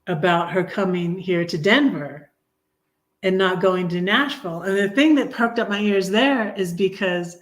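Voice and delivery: usual speaking voice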